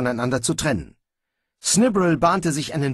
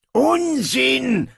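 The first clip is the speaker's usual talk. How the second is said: scoffing; 13 semitones higher, 4 dB louder than usual. Acted. Dwarf scoffs.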